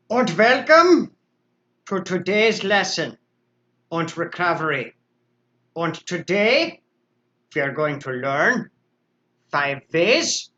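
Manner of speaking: a put-on vaguely Indian or Russian accent, lightly done; unplaced foreign accent. Livestream host opening a recovery lesson, in a vague foreign accent. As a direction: slip into an odd foreign accent for the intro. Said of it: "I don't know where this accent is coming from".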